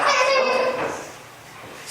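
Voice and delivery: very high, slow, drawn-out squeak; silly high voice. Pretend. Joking about a key that won't open the lock, high and slow.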